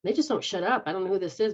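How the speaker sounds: ordinary talk, nothing marked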